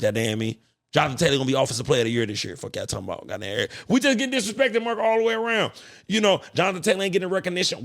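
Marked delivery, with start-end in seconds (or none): 3.9-7.8 high-pitched sports-rant indignation; aggrieved, high-pitched protest; indignant complaint. Real, not acted. Ranting that his team is slighted.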